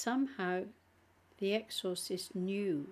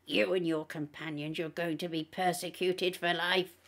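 sneering demon; 4 dB louder than usual. A narrator's reading. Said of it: "the demon sneered".

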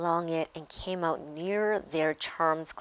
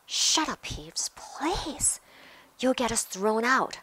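higher-pitched anxious plea; urgent pleading; 5 semitones higher than usual. A narrator's reading. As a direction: beg him to keep it down. Children plead with noisy Peeves.

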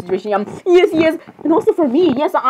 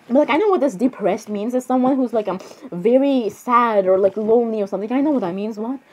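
ordinary talk

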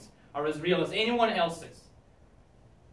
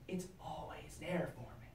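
whispering; 16 dB quieter than usual. Acted. Whispered tenderly about television.

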